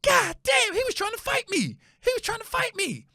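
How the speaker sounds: high-pitched mock outrage; stunned dad. Son imitating dad ranting to himself in the truck, high-pitched disbelief. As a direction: go high and incredulous, as a caricature. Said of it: "he kept saying".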